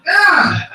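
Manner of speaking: high, loud burst of a yell; sudden yell. Acted. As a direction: yelp as a cat springs at you. Acts out the cat attack.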